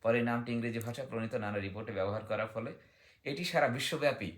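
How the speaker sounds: ordinary talk, nothing marked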